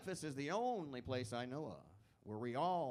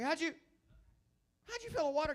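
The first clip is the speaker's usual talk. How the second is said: baffled stranger; 5 dB louder, faster than usual. Retold or imitated. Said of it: "They're like".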